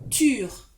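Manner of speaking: teacher pointing out the rhyming syllable; stressed syllable. Shown as a demonstration. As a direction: punch out "ture". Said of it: "c'est la syllabe".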